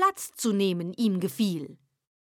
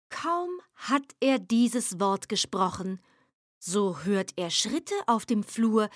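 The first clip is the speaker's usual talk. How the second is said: plain reading voice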